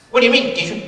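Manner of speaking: put-on high, bright-eyed kid's question; young student's reply. Young Louis asking his speech teacher, high and eager.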